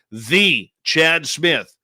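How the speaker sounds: long, drawn-out THE, said "thee"; slow right down, setting "The" apart before the name; grand emphatic introduction. Real, not acted. Host hypes [name] to everyone, slow stress on THE.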